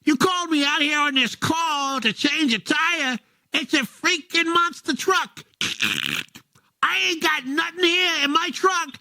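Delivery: high, whiny radio call to dispatch; tow driver on radio. Acted. Tow driver griping to dispatch about a monster truck, high and whiny. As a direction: complain to dispatch like a stranded mechanic.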